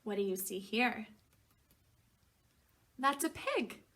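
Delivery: plain reading voice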